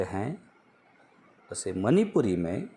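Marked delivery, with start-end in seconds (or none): none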